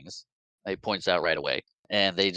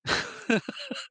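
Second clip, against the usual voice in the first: high squealing laugh; 4 dB quieter than usual. Real, not acted. Reaction to shot chicken.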